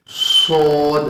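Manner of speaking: سود with a hissing, whistling s; whistled s demo. Shown as a demonstration. Teacher showing the whistling sad sound, hissed. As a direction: let سود whistle through the teeth. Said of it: "سیٹی کے ساتھ اس کی آواز نکلے گی".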